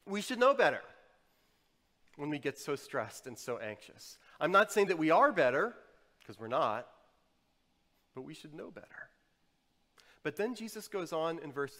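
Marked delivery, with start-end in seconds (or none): 0.1-0.8 raised, loud admonition; emphatic reproach. Real, not acted. Preacher chiding jaded Christians and himself, emphatic reproach. Self-reproach, said forcefully first. 8.1-9.1 very soft, sober admission; hushed concession. Real, not acted. Preacher admitting shared failing to congregation, hushed concession. Same reproach, softly conceded.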